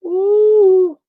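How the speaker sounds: loud high held falsetto ooh; long loud high ooh; Darren Fleet ooh. Retold or imitated. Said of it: "In the words of Darren Fleet".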